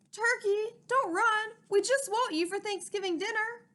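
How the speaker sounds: high, loud call on the run; Mister Moose calling after the running turkey. Acted. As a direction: call after the fleeing bird.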